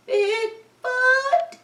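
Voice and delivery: high, wavering scared question; frightened squeak. Acted. Ben seeing Bigfoot up close, high and scared.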